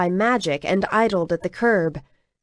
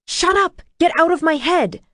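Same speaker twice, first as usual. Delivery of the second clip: higher and louder, furious shout; angry scream; 9 semitones higher, 4 dB louder than usual. A narrator's reading. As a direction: yell him out of your head. She screams at Dragos.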